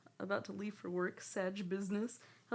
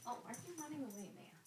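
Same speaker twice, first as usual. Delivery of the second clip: quick pet talk; 5 semitones higher, 8 dB quieter, much faster than usual. Real, not acted. Fast to cat.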